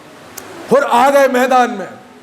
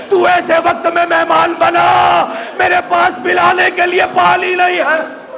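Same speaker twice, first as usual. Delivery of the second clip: raised, ringing majlis recitation, near shouting; preaching at full force; 4 semitones higher than usual. Acted. Preacher voicing Husayn's welcome to Hurr, in a raised ringing cry. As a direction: lift the hall with Husayn's words to Hurr.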